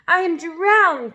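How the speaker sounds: slow, loud, high gasp; gasping, breathless cry. Acted. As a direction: gasp it out half drowned.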